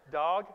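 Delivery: "Dog" said loud as Hebrew dag, with an open "ah" vowel; a teacher's loud model of "Dog" for the class to repeat; Hebrew pronunciation. Shown as a demonstration. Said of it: "Can you try that?".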